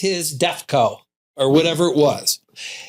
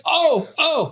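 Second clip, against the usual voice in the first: put-on eager kid calling out; 11 semitones higher than usual. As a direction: beg the teacher to call on you. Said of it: "you're like".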